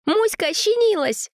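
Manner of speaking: high bellowed news; excited shout. A narrator's reading. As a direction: yell it out thrilled. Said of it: "проорал Данька".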